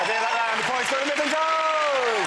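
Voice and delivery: high, drawn-out winner announcement; triumphant announcement. Real, not acted. Host awarding the round to a panellist, triumphant announcement. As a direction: stretch the name out in rising triumph.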